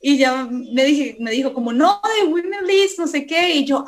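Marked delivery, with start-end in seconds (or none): 1.7-3.5 high, explaining as Gaby; lightly voiced caller, patient; a hint of Gaby insisting; voicing Gaby. Gaby voiced in retold call.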